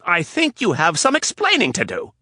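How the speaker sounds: high, loud furious tirade; yelled. Acted. Anna yelling at Rapp for arriving late, furious. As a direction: let her have it. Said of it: "She yelled".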